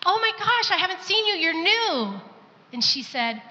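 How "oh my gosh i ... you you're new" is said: much higher, bubbly hello; delighted greeting. Retold or imitated. Woman at the drive-through greeting a new server, bubbly and much higher. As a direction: greet her like a long-lost friend, pitched way up. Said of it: "I said".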